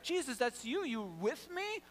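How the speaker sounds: high, excited, cocky patter; acted upbeat bravado; unafraid, eager Peter. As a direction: play a fearless man raring to go. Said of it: "Peter is not even scared".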